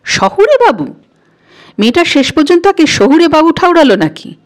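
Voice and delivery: high-pitched, indignant disbelief; incredulous echo. A narrator's reading. Stung young man muses on the girl's insult, incredulous. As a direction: repeat the insult as if you can't believe it, voice jumping high.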